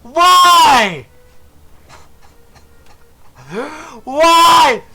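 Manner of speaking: high, loud wailing why; anguished cry. Real, not acted. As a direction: howl at the lost chance. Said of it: "going through a Greek tragedy".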